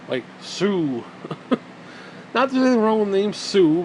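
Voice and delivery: usual speaking voice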